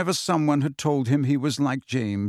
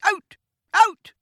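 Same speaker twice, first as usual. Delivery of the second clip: much higher, louder shriek; screamed; 6 dB louder, much slower than usual. A narrator's reading. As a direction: shriek at the pupils in fury. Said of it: "she screamed".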